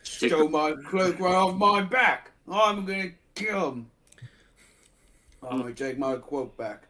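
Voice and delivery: ordinary talk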